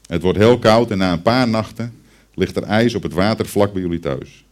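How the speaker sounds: ordinary talk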